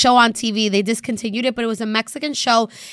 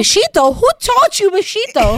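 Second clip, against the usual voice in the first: higher, incredulous teasing with "besito" said beshito; mock-outraged rise, louder on the question; mocking dad; 7 semitones higher, 4 dB louder than usual. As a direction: poke fun at the Portuguese-style "besito". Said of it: "my dad always makes fun of her".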